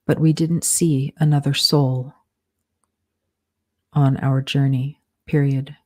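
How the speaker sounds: slow dictation, punctuation spoken aloud; dictating. Shown as a demonstration. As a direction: dictate it word by word with pauses.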